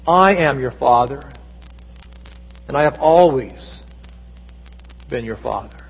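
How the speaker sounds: low, unhurried voice with pauses, softening at the end; calm divine reassurance. Acted. God's voice to the woman alone in her kitchen, calm and low. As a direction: let each phrase settle, quietly sure.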